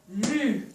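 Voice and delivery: "Lune" split as "lu... ne"; two detached syllables in "Lune"; reading by syllables. Shown as a demonstration. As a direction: draw out "lu", pause, then a separate "ne". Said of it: "lisons les syllabes de lune".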